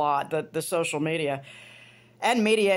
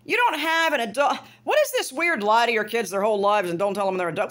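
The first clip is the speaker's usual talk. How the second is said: normal talking voice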